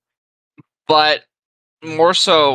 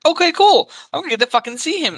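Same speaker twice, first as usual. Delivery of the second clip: high, eager burst of excitement; like a fan about to see his hero; excited; 13 semitones higher than usual. Retold or imitated. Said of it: "and I'm like".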